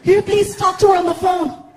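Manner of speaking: put-on high-pitched, excited young woman's voice; gushing fan girl. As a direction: gush and plead like a starstruck fan. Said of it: "and she's like".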